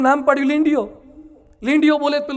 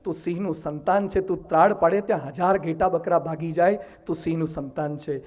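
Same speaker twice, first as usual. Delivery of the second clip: hushed, solemn lion voice; lion's earnest reveal; 8 semitones lower, 7 dB quieter than usual. Acted. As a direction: quietly tell him who he really is. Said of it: "સિંહ બોલ્યો".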